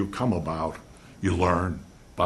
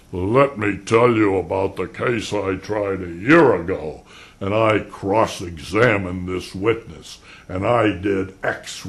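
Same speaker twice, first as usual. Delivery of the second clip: boastful lawyer storyteller; 7 dB louder than usual. Retold or imitated. Defense lawyer boasting to colleagues at the bar, loud. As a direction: brag about a trial over drinks. Lawyers swapping war stories.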